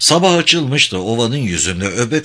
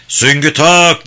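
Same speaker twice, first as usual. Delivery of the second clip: loud, high officer's order; shouted command; 6 semitones higher, 7 dB louder, slower than usual. A narrator's reading. Captain ordering his men to fix bayonets, shouting. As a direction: bellow it to the troops. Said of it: "diye bağırdı yüzbaşı".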